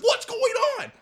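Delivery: shocked disbelief. Retold or imitated. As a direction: squeal it in total shock. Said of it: "And I was like".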